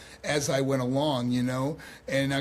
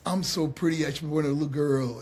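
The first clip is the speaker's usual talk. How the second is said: understated copy of a flamboyant catchphrase; trainee's plain attempt. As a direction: try the line without the swagger. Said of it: "I'd try to walk and do the thing".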